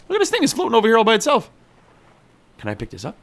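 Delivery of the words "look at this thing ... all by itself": loud, very high astonished voice; high-pitched amazement. Real, not acted. Player spotting the loose buoy ball, high-pitched amazement. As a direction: exclaim it high, baffled by the sight.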